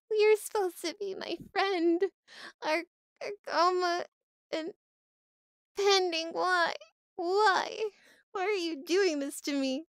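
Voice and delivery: high-pitched plaintive whine; hammed-up pitiful complaint; mock betrayal. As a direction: plead as if wounded by a friend. Mock betrayed plea to friends.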